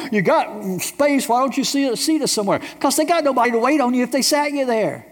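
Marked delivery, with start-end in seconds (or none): 0.1-2.6 mock grumbling customer. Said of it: "you just complain and grumble".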